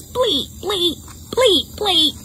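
high little bleats; lamb bleat. Shown as a demonstration. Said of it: "And a lamb says".

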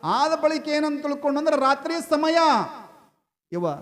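high, loud, ringing narration; full-force story preaching. Acted. Preacher telling of young Rama seeing moonlight, full-force preaching.

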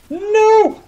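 high, loud cry of protest; shouted no. Real, not acted. Gamer caught out mid-match, high shouted protest. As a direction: yell it high in sudden dismay. Gamer reacts to sudden setback.

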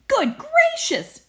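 very high, slow startled gasp; fussy teacher aghast; shocked exclamation. Acted. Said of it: "she exclaimed".

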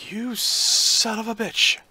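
slow, high-pitched exasperated curse; exasperated cursing. Real, not acted. Player cursing the raiders, exasperated. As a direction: drag out the curse, pitched well up.

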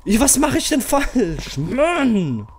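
furious player yelling at the controls; real strained rage. Real, not acted. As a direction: shout until the voice gives out. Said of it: "Jetzt geht meine Stimme schon weg".

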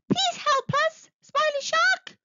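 very high, pleading little-fish voice; crying out. A narrator's reading. Trapped fish beg Smiley Shark for help, high pleading cry. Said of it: "cried the fish".

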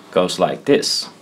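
usual speaking voice

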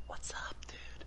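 breathy whisper into the mic. Real, not acted. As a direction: say it in a hushed whisper. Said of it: "why are you whispering?".

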